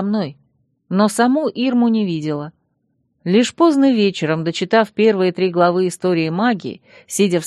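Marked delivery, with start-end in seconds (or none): none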